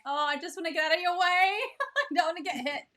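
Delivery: high, fast, laughing mock fear; playfully scared of getting hit; mock panic. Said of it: "And you're like".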